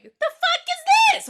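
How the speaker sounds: very high, loud, slow outcry; mock shocked yell. Co-host acts out the town people, hammed-up shock. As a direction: shriek it in exaggerated disbelief.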